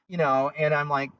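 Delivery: ordinary talk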